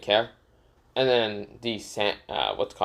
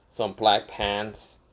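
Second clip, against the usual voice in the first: ordinary delivery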